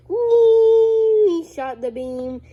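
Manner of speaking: very high, loud, stretched strained cry; excited blast. Real, not acted. Kid reliving the beam blast, very high strained yell. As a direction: scream it like the beam is firing.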